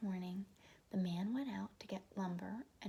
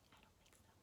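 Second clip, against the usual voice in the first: whispered aside; 28 dB quieter, faster than usual. Real, not acted. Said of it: "whispered aside".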